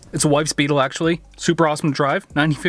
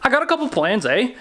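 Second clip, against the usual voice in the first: mock Canadian; 6 semitones higher than usual. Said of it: "Gina told me I needed more Canadian".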